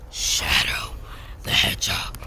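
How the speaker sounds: dramatic Shadow whisper; dark whisper behind you. Acted. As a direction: breathe it like a brooding anti-hero. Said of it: "dramatic Shadow impersonation voice".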